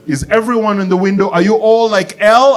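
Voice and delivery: high sing-song teacher voice; kindergarten teacher mode. Acted. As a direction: quiz the class like little children. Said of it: "kindergarten mode".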